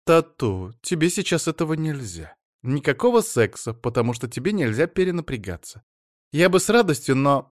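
wife fussing over her husband, in a high chatter. Acted. Wife character voice.

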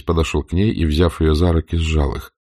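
ordinary delivery

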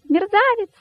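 very high squeaky insult; cheeky high-pitched rabbit; rabbit from inside a belly. A narrator's reading. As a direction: pipe the insult up in a tiny high voice. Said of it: "произнёс кролик из живота косого".

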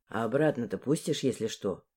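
low, soft, cheeky male voice; smirking young man. A narrator's reading. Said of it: "ухмыльнулся Дмитрий".

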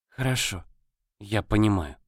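put-on soft, resigned girl; calmed down. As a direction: quietly give in. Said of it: "успокоилась Аннели".

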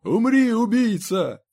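high, loud scream of attack; furious, frightened cry; panicked shout. A narrator's reading. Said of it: "закричал Сайлас".

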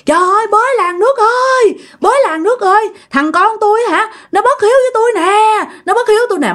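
put-on an old woman raising the alarm to the neighbours; crying out to the village. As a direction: scream that your son is unfilial. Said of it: "bà nội mới la làng lên".

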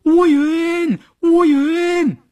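high, stretched cries of a name; alarmed shout. A narrator's reading. Women soldiers see Wuyun in the river, alarmed high calling. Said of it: "大惊失色地喊".

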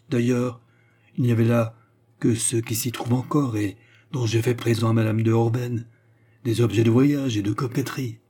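usual speaking voice

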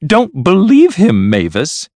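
loud, high-pitched plea; crying out. A narrator's reading. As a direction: plead slowly at full voice. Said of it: "cried Lady Blemley".

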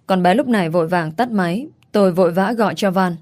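ordinary delivery

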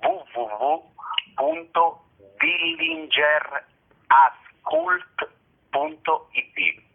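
slow, deliberate web-address dictation; spelling it out. Real, not acted. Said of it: "facendo spelling".

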